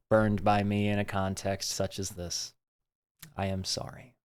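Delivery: quietly sorry, from the heart; sincere apology. Real, not acted. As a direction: speak it gently, as a real apology to people you hurt. Heartfelt apology to people hurt.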